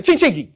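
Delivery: high, ringing "ching, chingy"; playful ching chant. Shown as a demonstration. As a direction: model a bright cash chime for the crowd. Leads audience in the ching chant.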